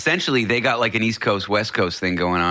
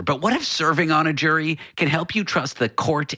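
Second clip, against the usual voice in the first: higher-pitched announcer's tease; radio teaser read; 6 semitones higher than usual. Real, not acted. Host teasing the next story after the break, radio teaser. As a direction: sell the upcoming segment to listeners.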